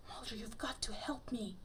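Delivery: urgent breathy whisper, pleading; desperate whisper. A narrator's reading. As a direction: whisper it desperately, begging for help. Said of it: "Her voice was a desperate whisper".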